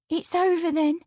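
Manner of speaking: high, hesitant maid's question; hesitant young woman. A narrator's reading. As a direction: ask shyly, hoping. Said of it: "with a pretty hesitation".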